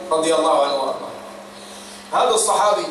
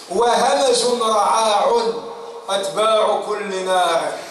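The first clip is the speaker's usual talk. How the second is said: slow, rising preacher's declamation; solemn preaching recitation; 4 semitones higher, slower than usual. Shown as a demonstration. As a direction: declaim it slowly and gravely. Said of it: "quoting Ali's saying". (إلقاء خطابي بطيء ومهيب)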